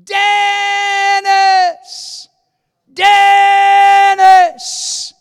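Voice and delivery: very high, long-held scream of the name; full-force re-enacted yell; crying out. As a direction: wail it as the remembered prayer. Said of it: "he heard these words in his heart".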